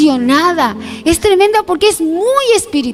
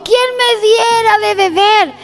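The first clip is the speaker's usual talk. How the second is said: vehement exclamation; 6 semitones higher than usual. Retold or imitated. Thirsty David crying out in the cave, vehement. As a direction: cry out the wish with vehemence. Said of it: "porque dice con vehemencia".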